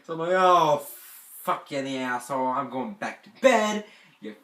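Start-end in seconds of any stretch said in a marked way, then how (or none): 0.3-3.9 loud retold outburst; mad. Camper cursing out the bug sprayers, loud retold anger. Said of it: "And I got so mad". 4.2-4.4 loud, higher-pitched retold threat; angry threat. Camper threatening the boys who sprayed them, loud mock-furious warning. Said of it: "I was not joking".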